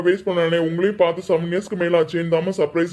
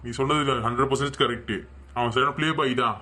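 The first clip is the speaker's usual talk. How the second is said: ordinary talk, nothing marked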